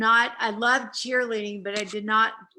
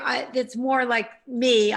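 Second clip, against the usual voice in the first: normal talking voice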